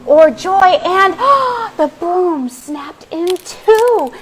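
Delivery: high, loud and building; rising alarm. A narrator's reading. Narrator as the broom breaks, high and loud. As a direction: let the excitement burst as the broom snaps.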